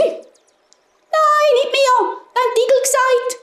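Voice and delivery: high, fast squeaky denial; protesting mice. A narrator's reading. Mice pass blame to the hedgehogs, high squeaky protest.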